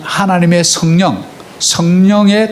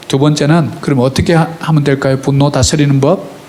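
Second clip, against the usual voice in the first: ordinary talk, nothing marked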